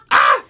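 short, loud scare yell; imitated startled yell. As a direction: mimic a man jumping at a scare. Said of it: "Richie will be like".

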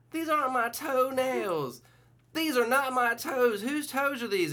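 put-on high, slow confused old woman; old lady voice. Woman in the home insisting to staff, high confused voice. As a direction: quaver it like a bewildered grandma.

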